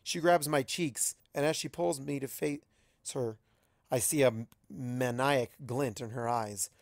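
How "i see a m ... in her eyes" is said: ordinary delivery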